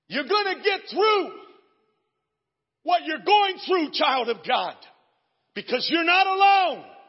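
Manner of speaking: high, loud, hammering preacher's shout; preaching at full force. Acted. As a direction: drive every line home to the crowd. Preaching climax.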